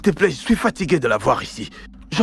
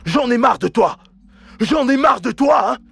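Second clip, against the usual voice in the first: higher, louder, fed-up yelling; angry shout; 10 semitones higher, 3 dB louder than usual. Acted. Son yelling at the girlfriend at his door, furious. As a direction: blast her away in fury.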